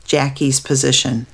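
usual speaking voice

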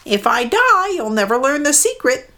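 a small bird talking back; mud hen. Acted. Mud hen defying Maui, high bird voice. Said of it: "the mud hen answered".

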